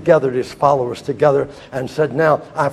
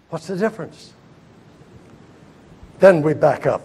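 normal talking voice

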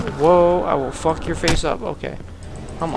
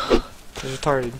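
usual speaking voice

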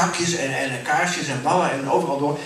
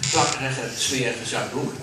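ordinary delivery

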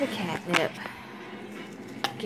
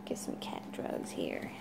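whisper; 14 dB quieter than usual. Real, not acted. Sneaking catnip to cats.

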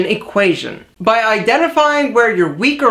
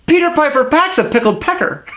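normal talking voice

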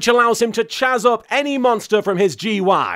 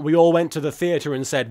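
ordinary delivery

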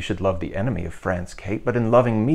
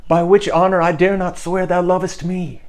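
passionate declaration; 7 semitones higher, 6 dB louder than usual. Acted. Henry's passionate declaration of love.

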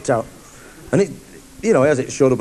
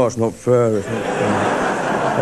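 mock whine; 5 semitones lower, much slower than usual. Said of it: "all the Morrises in the audience will all go".

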